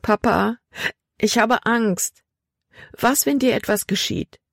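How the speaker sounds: put-on a scared girl holding back tears; mild tearful fear, pitched high. A narrator's reading. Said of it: "Tränen stiegen Libby in die Augen".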